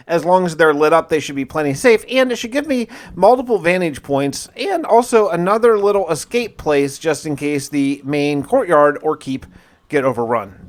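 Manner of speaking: usual speaking voice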